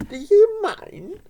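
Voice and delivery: very high, slow indignant squawk; squawking hen. A narrator's reading. Henny Hen squawking at the sheepdog Beth. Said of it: "squawked Henny Hen".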